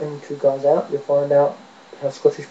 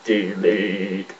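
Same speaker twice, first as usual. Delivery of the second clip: low, drawn-out robot voice; deep robotic command; Cyberman; 6 semitones lower, 5 dB quieter than usual. Shown as a demonstration. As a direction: drop low and intone it like a machine. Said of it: "if you do it a bit lower".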